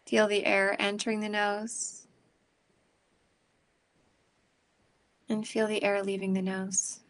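calm, unhurried breathing guidance; guided meditation. Acted. Leads a meditation.

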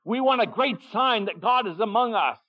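high, insistent voice of the people; demanding crowd. Retold or imitated. The people crave a sign, pitched high. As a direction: plead for a great sign.

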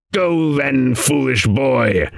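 high, slowing raven rebuke; angry croak. A narrator's reading. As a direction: dismiss him crossly as a raven, drawing out the end. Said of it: "with anger in his croak".

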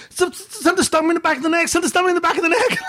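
high-pitched, stammering start, then rushed; panicked imitation. Son telling dad he was stung, mock panic. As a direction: blurt it twice in mock panic.

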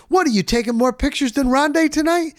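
ribbing. Retold or imitated. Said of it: "and I said".

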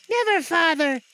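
put-on high, loud, fervent child's voice; children crying out. Children promising their father, fervent cry. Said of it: "cried they all".

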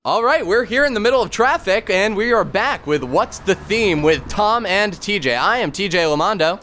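upbeat show host, high and bright; radio host voice. Acted. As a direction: announce like a cheesy radio host.

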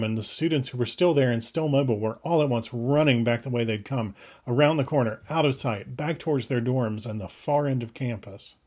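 usual speaking voice

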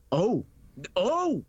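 retold "oh" climbing high as it clicks; dawning realization. Ticket seller grasping what kind of movie, dawning realization. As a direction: let the penny drop, voice rising sharply.

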